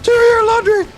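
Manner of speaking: very high, loud squeaky chant; squeaky jingle voice. Acted. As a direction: screech it like a cartoon ad. Imitates sponsor jingle.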